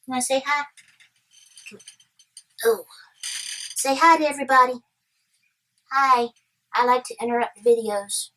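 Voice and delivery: high-pitched coaxing chatter; cutesy pet talk. Real, not acted. Voicing and coaxing the interrupting visitor.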